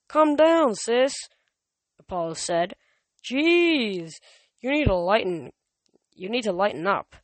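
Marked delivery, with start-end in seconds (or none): 0.1-1.1 higher, loud brotherly teasing. A narrator's reading. Apollo teases his sister. 3.2-7.1 teasing. A narrator's reading. Apollo teases his sister.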